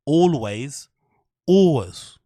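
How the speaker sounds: "Always" mimicked as "ol-wiz"; odd always. Shown as a demonstration. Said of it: "Have you ever heard anybody pronounce".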